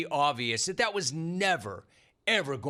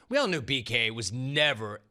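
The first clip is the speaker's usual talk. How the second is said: usual speaking voice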